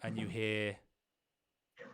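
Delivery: slow, low, hushed narration; suspenseful scene setting. Acted. Game master describing the emptied tent, hushed and ominous. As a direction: draw it out softly to build suspense.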